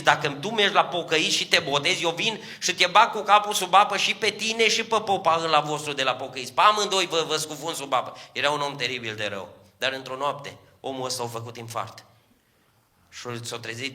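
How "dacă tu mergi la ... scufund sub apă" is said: raised, louder threat to drown her; a husband shouting a threat at his wife; husband's threat. Retold or imitated.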